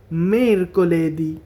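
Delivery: ordinary talk, nothing marked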